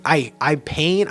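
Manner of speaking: normal talking voice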